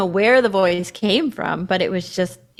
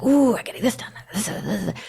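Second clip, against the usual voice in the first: excited whisper; 3 semitones higher than usual. Retold or imitated.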